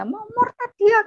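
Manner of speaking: high, loud scandalised accusation; accusing yell. Retold or imitated. Older sibling accuses younger one to mother, high outraged yell. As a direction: shout it as a denunciation. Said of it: "Ngapain sih teriak-teriak".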